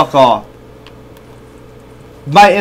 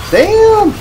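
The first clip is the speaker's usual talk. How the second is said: excited shout; 6 dB louder than usual. Real, not acted. Good pull from a pack.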